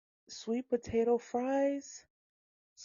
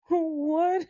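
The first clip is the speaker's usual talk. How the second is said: ordinary delivery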